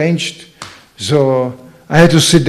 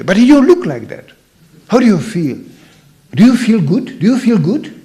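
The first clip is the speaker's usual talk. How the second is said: pushy counsellor; 5 semitones higher than usual. Retold or imitated. Mock counsellor pressing devotee.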